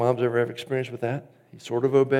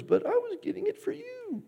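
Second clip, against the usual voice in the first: put-on much higher, squeaky child's excuse through a laugh; caught kid; 6 dB quieter than usual. Cosby's kid caught with candy, giggly high-pitched excuse. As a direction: play the guilty little kid pleading innocence. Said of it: "he catches his kid ... he goes".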